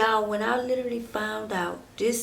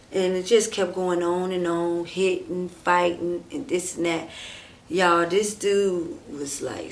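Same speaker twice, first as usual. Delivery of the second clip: usual speaking voice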